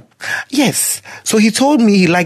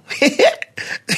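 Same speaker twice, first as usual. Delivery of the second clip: high giggle; 10 semitones higher than usual. Real, not acted. Laughs after cocky demo.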